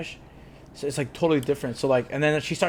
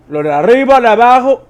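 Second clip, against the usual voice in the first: loud, higher rapid Puerto Rican Spanish; mock Puerto Rican Spanish; 7 semitones higher, 12 dB louder, faster than usual. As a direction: rattle it off like a fast Caribbean speaker. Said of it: "That's, that's literally what I hear".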